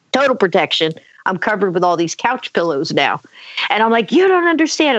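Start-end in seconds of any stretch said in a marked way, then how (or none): 4.1-5.0 high, pleading kid, acted out; raised pitch, mild screamed protest; mock scream. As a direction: imitate a frantic child not being believed. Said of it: "I'm screaming through the pillows".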